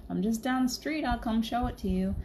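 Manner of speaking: [name]. Retold or imitated. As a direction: put on a southern man's accent. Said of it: "in a southern accent".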